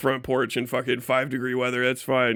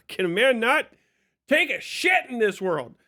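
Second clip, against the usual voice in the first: exasperated groan; 6 semitones higher than usual. Retold or imitated. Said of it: "I'm like".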